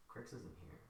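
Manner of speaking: very soft, near-whispered reply; hushed unmasked stranger. Acted. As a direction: answer barely above a breath. GM voices the unmasked man.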